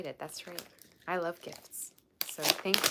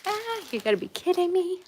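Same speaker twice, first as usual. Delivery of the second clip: much higher, much louder cry of delighted disbelief; 10 dB louder than usual. Real, not acted. Streamer unboxing a viewer's gift, shrieking in delighted disbelief. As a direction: squeal it out, unable to believe the gift.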